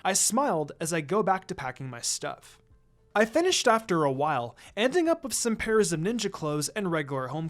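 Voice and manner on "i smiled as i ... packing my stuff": plain reading voice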